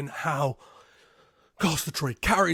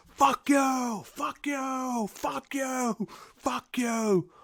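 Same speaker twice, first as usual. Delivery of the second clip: high, yelling fuck you at everyone; furious meltdown; 9 semitones higher, much slower than usual. Retold or imitated. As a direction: scream it, losing it completely. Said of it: "he just fucking flips out, pointing at everyone going".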